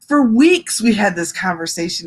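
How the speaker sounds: usual speaking voice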